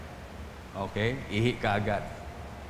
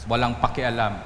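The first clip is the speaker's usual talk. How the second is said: ordinary delivery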